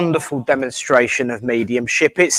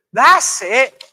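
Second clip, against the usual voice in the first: ordinary talk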